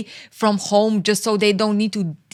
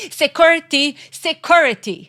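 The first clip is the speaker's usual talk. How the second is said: high, louder mock alarm call; calling security; 7 semitones higher, 4 dB louder than usual. Mock calling for security at a calm man. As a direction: pretend to summon guards.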